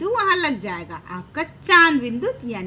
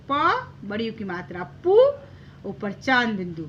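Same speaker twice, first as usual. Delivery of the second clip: normal talking voice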